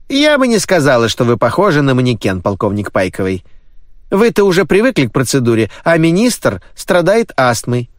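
high, brisk middle-aged woman; brisk nanny. A narrator's reading. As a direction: fuss over him like a bossy nanny. The nanny answers the colonel.

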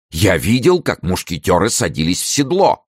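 high, urgent announcement; agitated report. A narrator's reading. Gourville warning that musketeers are mounting, agitated. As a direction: deliver the alarming news at high pitch.

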